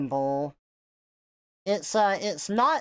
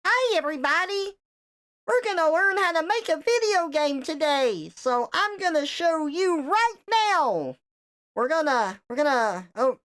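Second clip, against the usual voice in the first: much higher, bubbly tutorial host voice; kids' software host; 10 semitones higher than usual. Pretend. Playing the kids' software host for chat, high and peppy. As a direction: chirp it out like a cheesy kids' program guide. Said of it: "You are the Toon Pirate software now".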